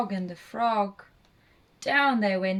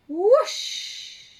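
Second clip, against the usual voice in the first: whoosh drawn out, high and loud; long, airy storybook whoosh; whooshing sound word; 13 semitones higher, 5 dB louder than usual. A narrator's reading. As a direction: send the word rushing off like a broom. Broomstick takes off in story.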